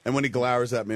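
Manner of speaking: normal talking voice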